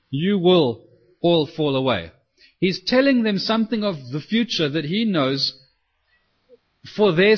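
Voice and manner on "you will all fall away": slow, spaced-out statement of fact; matter-of-fact. Acted. Jesus telling his disciples what will happen, matter-of-fact. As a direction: state it slowly, without accusation. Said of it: "As a matter of fact".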